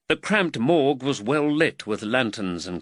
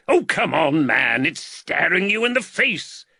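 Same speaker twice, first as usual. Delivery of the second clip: louder and higher, exasperated; impatient outburst; 8 semitones higher, 5 dB louder than usual. Acted. Surgeon badgering his nervous student, impatient louder outburst. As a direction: snap at the student to get on with it.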